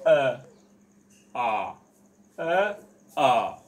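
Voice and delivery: अ and आ each given full, loud and drawn out; correct pronunciation demo. Shown as a demonstration. Teacher showing students the pure अ and आ. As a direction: sound अ, आ clearly from the throat, loud. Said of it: "अब शुद्ध जो होता है वो देखिए".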